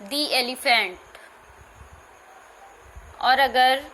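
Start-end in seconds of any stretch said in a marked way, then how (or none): 0.0-1.0 दी elephant with a long "ee" in दी; "dee" before a vowel. Shown as a demonstration. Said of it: "उसके पहले हम दी पढ़ते हैं".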